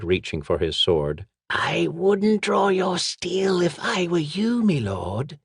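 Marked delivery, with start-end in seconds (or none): 1.5-5.4 much higher, slow hissing woman's voice; sly hissed threat from the shadows. Acted. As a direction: hiss the warning like a street thief. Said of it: "the fog-cloaked silhouette hissed".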